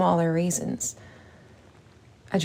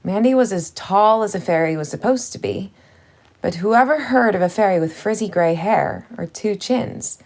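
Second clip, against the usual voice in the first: ordinary delivery, nothing marked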